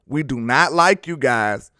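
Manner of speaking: slow, deliberate, every word stressed; firm slow emphasis on NOT; slow and clear. Real, not acted. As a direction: lay it out slowly so nobody misses it. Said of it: "I don't know if I could be clearer".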